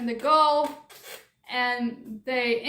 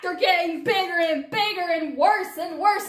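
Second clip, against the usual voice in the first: higher, louder, rushing alarm; mock panic; 4 semitones higher, 3 dB louder than usual. Narrator warns the world, hammed-up panic shout. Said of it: "own building panic, shouted".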